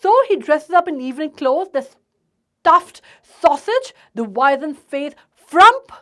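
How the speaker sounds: loud, slow, spitting out insults; furious outburst. Acted. Father furious at the suitor, loud insulting rant. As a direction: rage at the suitor like a livid father. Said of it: "अब गुस्से में वो एकदम आग बबूला है".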